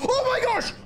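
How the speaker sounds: high-pitched burst of surprise; shocked exclamation. Real, not acted. Host seeing a contestant almost get a godly, shocked.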